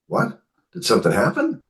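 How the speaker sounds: high, questioning "what?" in retelling; retold surprise. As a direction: reenact being taken aback by sudden news. Retelling alarmed reaction to news.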